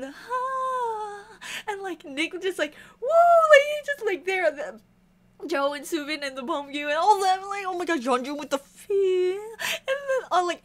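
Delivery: high, tearful gushing through sobs; crying from joy, voice breaking high; happy crying. Real, not acted. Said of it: "I did not expect to cry".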